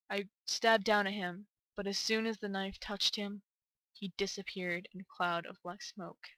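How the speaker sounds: subdued narration, slowing and softening toward the end; horror story narration. A narrator's reading. Narrator recounts the knife piercing James, subdued horror narration.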